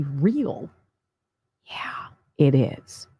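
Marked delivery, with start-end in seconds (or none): 1.7-3.1 slow hushed whisper. Acted. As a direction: lean in and whisper it. Whispered aside after claim.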